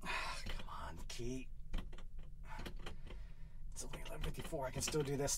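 soft, barely voiced urgency; whispered sneaking in; hushed whisper. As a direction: keep quiet so she won't hear. Sneaking in late at night.

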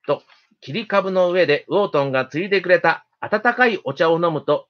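plain reading voice

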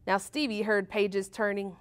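usual speaking voice

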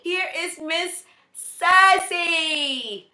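slow, high grand announcement; showman reveal. Real, not acted. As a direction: unveil her like a star. Presenting the new puppy.

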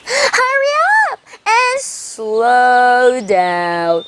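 slow, drawn-out hare call; hare crying out. Acted. 토끼가 거북이에게 외치는 길게 늘인 목소리. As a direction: stretch each word as the hare shouts. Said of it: "Hare cries".

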